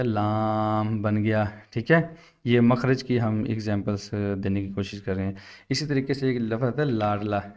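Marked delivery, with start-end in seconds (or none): 0.0-1.5 very slow, stretched words; slow teacher reveal; drawn-out teaching. Shown as a demonstration. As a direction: stretch it out for the child. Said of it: "R A को side पे किया".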